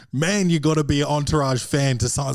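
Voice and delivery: ordinary talk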